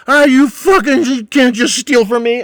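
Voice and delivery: high, loud slurring shout; drunken yell. Retold or imitated. Drunk man yelling back.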